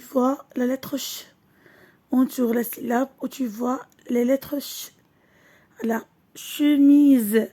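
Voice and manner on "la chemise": slow syllable reading. Shown as a demonstration. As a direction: stretch "chemise" slowly, syllable by syllable. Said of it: "teacher stretches word for syllables". (lecture très lente de "chemise")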